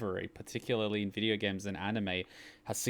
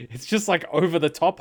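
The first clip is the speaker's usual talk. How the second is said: louder and higher, amused; laughing excitement; 7 semitones higher, 8 dB louder than usual. Real, not acted. Fan amused by over-the-top acting, excited. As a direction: bubble over.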